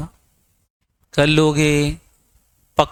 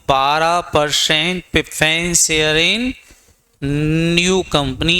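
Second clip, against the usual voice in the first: slow dictation; much slower than usual. Shown as a demonstration. Teacher dictating notes to students.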